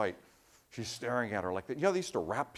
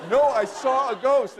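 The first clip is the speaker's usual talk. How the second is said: much louder and higher, slow insistence; insistent frightened woman; 12 semitones higher, 12 dB louder, slower than usual. Retold or imitated. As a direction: dig in: she really saw a ghost, louder. Insisting after seeing a ghost.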